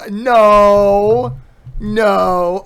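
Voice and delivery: loud flustered denial; loud protest. Real, not acted. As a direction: shout no no no. Denying accusation loudly.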